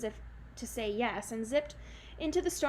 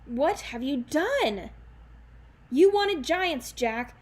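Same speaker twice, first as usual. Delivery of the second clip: angry rebuke; 4 semitones higher, 7 dB louder, slower than usual. Acted. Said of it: "Granny Relda said".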